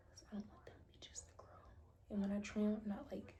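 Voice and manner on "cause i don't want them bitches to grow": quick hushed whisper. Real, not acted. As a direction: whisper it fast like a secret. Cheeky aside about cuticles.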